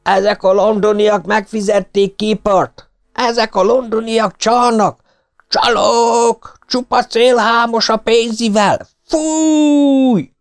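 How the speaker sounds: put-on old Scottish fan shouting accusations at the referee; angry shout. A narrator's reading. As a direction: shake your fist and scream at the referee. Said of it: "és ezt kiáltotta".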